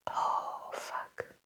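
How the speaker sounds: choked whispered curse; tearful whispered oh fuck; emotional whisper. Real, not acted. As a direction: whisper it, trying not to cry. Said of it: "now I'm getting emotional".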